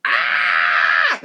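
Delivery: sudden loud low yelp; startled yelp. Real, not acted. Telling the subway story, breaks off with a loud yelp. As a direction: blurt out a startled ah. Sudden outburst mid-retelling.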